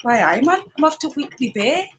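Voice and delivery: lilting Geordie that climbs in pitch; Geordie accent. Shown as a demonstration. Showing off the Geordie learned from nan, Geordie accent. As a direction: put on a chirpy Geordie accent. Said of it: "I can do Geordie".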